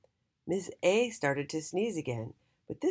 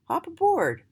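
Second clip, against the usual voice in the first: cheerful; 13 semitones higher, 7 dB louder than usual. Acted. Jolly inviting twins aboard.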